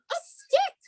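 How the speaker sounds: put-on high, bright cry of a girl; excited girl voice. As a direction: squeal it like an eager girl. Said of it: "cries the girl".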